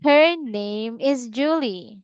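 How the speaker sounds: slow, careful teacher modelling. Shown as a demonstration. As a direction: spell it out slowly for the learner.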